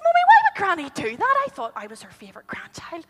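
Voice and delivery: put-on high, worried child's voice, ending in a whisper; a small girl fretting to her mum; little girl voice. Little Jessica asks mummy, high and worried.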